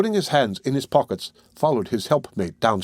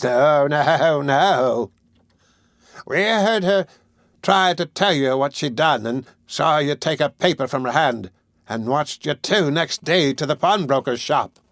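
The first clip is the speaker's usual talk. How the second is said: put-on old hag; 4 semitones higher, slower than usual. Old women exposing the matron, in a high crone voice. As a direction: cackle it out like an old witch.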